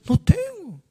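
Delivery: high, lightly mocking innocent denial. Preacher mocks people who claim no lust, high mock innocence. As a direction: play the liar claiming innocence. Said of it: "Algunos dicen".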